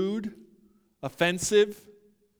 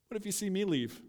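understated challenge; 5 semitones higher, faster than usual. Acted. Jesus to disciples about to leave, understated challenge.

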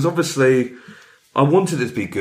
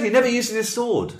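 normal talking voice

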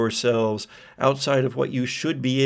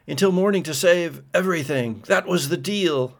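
higher, fast, urgent protest; desperate Kane; 5 semitones higher than usual. Acted. Kane protests to the entity, higher and rushed. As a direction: plead fast against a broken deal.

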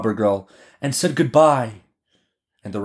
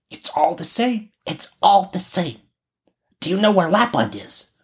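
put-on high, bossy child's voice; robber girl; 8 semitones higher than usual. A narrator's reading. Robber girl questions the reindeer, high-voiced. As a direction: play the little bandit girl up high. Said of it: "she asked the reindeer".

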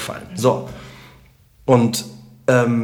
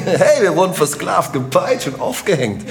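high, laughing fake cheer; hammed-up party jubilation, sarcastic; mock celebration; 5 semitones higher than usual.

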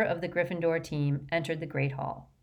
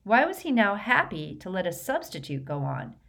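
ordinary delivery

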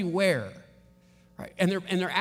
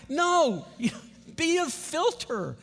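laughing no; 9 semitones higher, slower than usual. Real, not acted. Laughing correction of bad choice.

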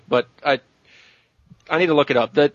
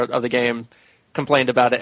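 ordinary talk

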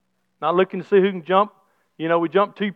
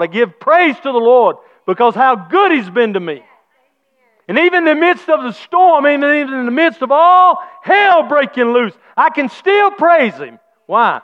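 higher, louder preacher's shout; fervent preaching with shouted thanks; preaching at full force; 5 semitones higher, 6 dB louder than usual. Acted. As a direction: lift it up like a Sunday preacher. Pastor preaching through the storm.

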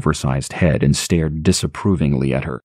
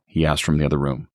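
ordinary delivery

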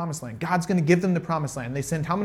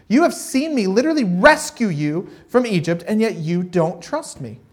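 God, hurt and angry; 5 semitones higher, 5 dB louder than usual. Retold or imitated. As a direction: voice God's hurt, pressing each line. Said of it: "God gets very, very angry".

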